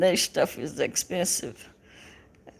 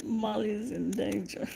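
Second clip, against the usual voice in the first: ordinary delivery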